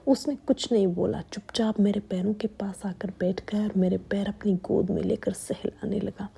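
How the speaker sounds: usual speaking voice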